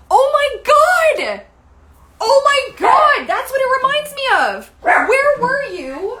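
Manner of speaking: high, drawn-out excited shriek; excited outburst. Real, not acted. Realizing what it reminds her of, excited outburst. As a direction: yell it out high and loud with sudden recognition.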